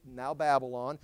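ordinary talk

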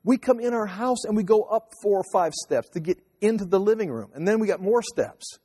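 wife fretting about the house steps, in a high imitation of her; imitating wife's complaint. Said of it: "She says".

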